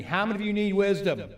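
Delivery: loud, high call to the congregation. Real, not acted. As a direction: raise your voice to rouse an unresponsive crowd. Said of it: "You're not hearing me".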